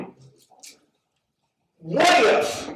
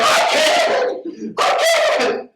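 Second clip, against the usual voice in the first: a squeaky, much higher mock sob; mock crying wail; much slower than usual. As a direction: imitate a panicked wife wailing on a rock. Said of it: "she's on a rock crying".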